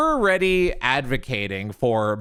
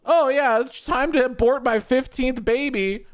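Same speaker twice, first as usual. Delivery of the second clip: high, breezy parody voice; mock casual woman; 8 semitones higher than usual. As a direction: imitate her blithely, pitched up high. Mimicking a careless aborting woman.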